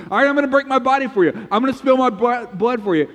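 fast, high and loud, hammed-up; mock grand Jesus. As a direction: rattle it off like an overeager speech. Mocking retelling of Jesus' words.

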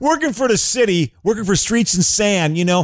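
Chicago city worker. Pretend. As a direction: play the Streets and Sand guy.

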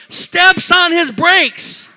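high, slow, loud telling of the brake slam; excited climax. Retold or imitated. Pastor telling congregation about the brake slam, high and loud. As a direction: throw the moment out big. Climax of road rage story.

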